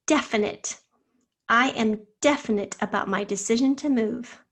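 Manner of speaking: ordinary talk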